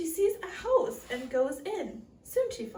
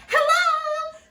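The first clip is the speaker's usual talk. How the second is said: high, loud, friendly hello; friendly greeting; 13 semitones higher, 12 dB louder than usual. A narrator's reading. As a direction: call out a big friendly greeting. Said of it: "says the friendly dwarf".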